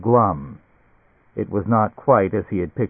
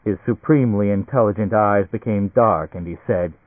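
ordinary delivery, nothing marked

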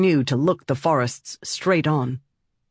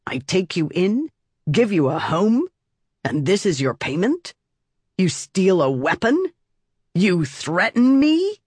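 angry scolding; 5 semitones higher than usual. Acted. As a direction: build outrage line by line. Aunt scolding the child.

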